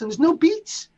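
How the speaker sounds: high, loud amazement; amazed. Real, not acted. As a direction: marvel at it, voice climbing. Amazed at no beats.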